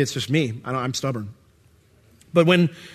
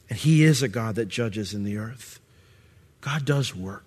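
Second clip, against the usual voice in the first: usual speaking voice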